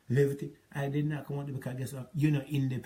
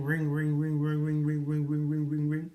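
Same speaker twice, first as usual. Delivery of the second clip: steady sing-song ringing imitation; imitated phone ring. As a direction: mimic the phone ringing over and over.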